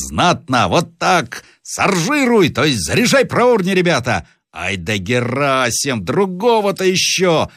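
high, excited cries of praise; возгласы сержанта. A narrator's reading. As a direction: urge the shooters on with relish. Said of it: "вскричал сержант". (азартный крик старого вояки)

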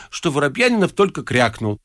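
ordinary delivery

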